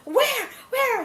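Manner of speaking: high, loud mimicked cry; imitated alarm. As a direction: mimic frantic parents asking. Acts out the family's alarm.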